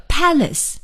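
"palace" with the first syllable stressed; PAL-ace, weight up front. Shown as a demonstration. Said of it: "是在第一音节".

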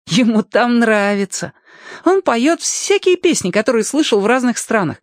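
put-on high, animated boyish voice; eager boy. Boy invites Alyoshka to meet the singing wind, eager high voice. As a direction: coax a new friend, pitched well up.

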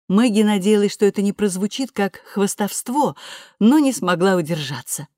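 ordinary delivery

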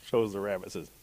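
ordinary delivery, nothing marked

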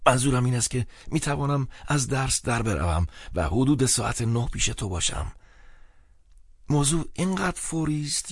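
high, soft pleading friend; anxious friend. Acted.

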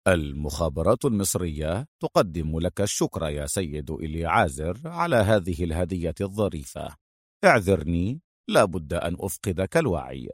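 soft, mock-polite thanks; understated sarcasm, quietly courteous; sarcastic. A narrator's reading. Adham thanks Eliezer at gunpoint, softly sarcastic. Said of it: "أدهم يقول ساخرا".

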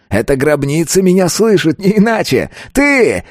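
high exultant yell, climbing to the end. A narrator's reading. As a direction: build up to a high accusing cry. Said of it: "заорал он". (ликующий крик, всё выше)

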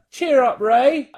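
high, slow and loud sing-song; slow, high, loud nudge; mock cheer. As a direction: call it out like a jolly catchphrase. Quoting in-jokes from live show.

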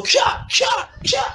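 sharp, high martial-arts cries; short, breathy "kia" bursts; imitated fighting kid. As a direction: mimic a child's kung-fu yells. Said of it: "Et puis, l'enfant sort".

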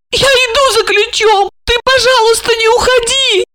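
very high, pitiful whimper; plaintive plea. A narrator's reading. Olga asking him not to leave, plaintive squeak. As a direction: beg in a tiny high voice. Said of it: "Ольга всхлипнула и жалобно попросила".

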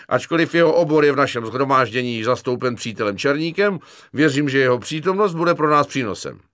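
loud, high ceremonial speech; pompous announcer. Acted. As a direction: present him like a master of ceremonies. Corporal's pompous formal introduction.

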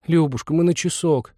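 put-on high, coaxing girl's voice; girlish begging; pleading girl. A narrator's reading. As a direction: wheedle for a small favour. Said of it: "просительно вернула первая".

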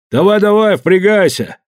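high barked order; rough shout. Acted. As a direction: shove them along loudly. Guards push the exiles.

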